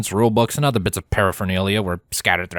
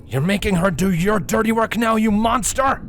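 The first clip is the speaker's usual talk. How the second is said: snarled; 11 semitones higher than usual. A narrator's reading. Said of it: "snarled Shining Armor".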